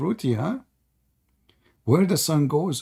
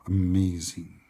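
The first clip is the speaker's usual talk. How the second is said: slow, low mock awe; sarcastic; 7 semitones lower, 6 dB quieter, slower than usual. Real, not acted. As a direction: marvel sarcastically, slow and quiet.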